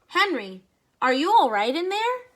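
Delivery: loud, high call through the crate. Acted. Friend calling loudly to Henry inside the box. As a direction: call in to him through the wood.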